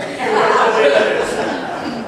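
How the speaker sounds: high, loud burst of laughter; real laughter. Real, not acted. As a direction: let the laugh out fully. Laughing at own story.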